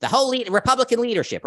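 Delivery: lightly voiced pundit, grudging and hurried; Ben Shapiro impression. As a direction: squeeze it out reluctantly as a mocking Ben Shapiro. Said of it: "Ben Shapiro can barely say it".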